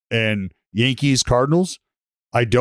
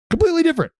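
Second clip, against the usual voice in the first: pitched way up in disbelief; high emphatic repeat; much faster than usual. Real, not acted. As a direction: shoot the voice up on completely.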